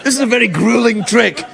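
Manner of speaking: lightly mimicked magician, pitched high; a high mock account of a grueling stunt; David Blaine. Magician boasting of his stunt in a box, mock-earnest. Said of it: "David Blaine".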